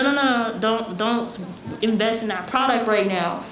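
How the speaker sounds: ordinary talk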